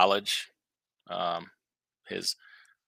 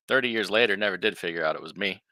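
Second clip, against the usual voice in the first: normal talking voice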